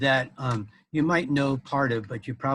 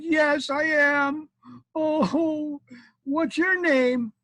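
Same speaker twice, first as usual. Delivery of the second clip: slow, very high mock wail; crying wife; 4 dB louder, much slower than usual. Pumpkin wife answers the knight, mock crying. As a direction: draw it out in a high, tearful put-on voice. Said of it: "And she was crying".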